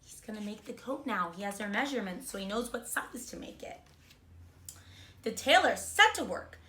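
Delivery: ordinary delivery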